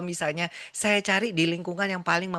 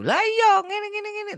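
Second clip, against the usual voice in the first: much higher, louder, fast indignant ranting; irritated doctor; 6 dB louder than usual. Retold or imitated. Angry doctor explaining himself to the nurse, indignant and loud. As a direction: rant back, defensive and annoyed.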